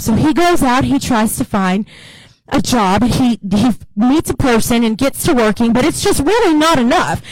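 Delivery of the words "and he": ordinary talk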